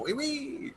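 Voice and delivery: high, soft, laughing oui oui; mock French oui oui. Teasing a friend about French, high mocking voice. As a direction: squeak out a silly fake French.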